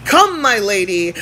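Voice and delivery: normal talking voice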